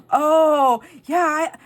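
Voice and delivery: imitating an earnest clerk, high and loud; loud, drawn-out high "oh", then hesitant. Young clerk taking her joke seriously, earnest. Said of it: "and he was so serious".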